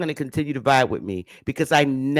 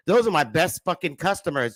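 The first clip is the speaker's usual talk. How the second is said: ordinary talk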